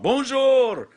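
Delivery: high, loud mock French accent; Apu with French accent. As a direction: burst out in a cartoonish French accent. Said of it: "foreign accent syndrome and have Apu just come out".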